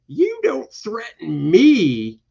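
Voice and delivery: high, slow, loud mock defiance; defiant heroine. Chained heroine answers the taunting villain, defiant. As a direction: act out a defiant heroine, drawn out.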